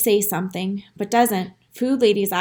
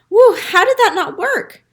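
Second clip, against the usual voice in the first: high, loud astonished cry; can't believe it failed; baffled exclaim; 10 semitones higher, 6 dB louder than usual. Acted. Dog stunned that his trick failed, loud surprise.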